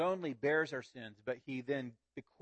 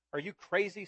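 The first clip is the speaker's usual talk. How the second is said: sneering; 7 semitones higher, 4 dB louder than usual. Acted. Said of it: "sneered the other".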